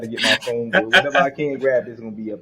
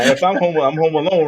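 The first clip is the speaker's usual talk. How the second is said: emphatic; 4 semitones higher, 7 dB louder than usual. Real, not acted. Emphatic setup.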